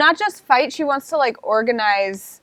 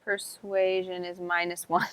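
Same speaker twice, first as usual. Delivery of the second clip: whispering; 3 semitones lower, 9 dB quieter, slower than usual. Real, not acted. Muttering dice roll aside.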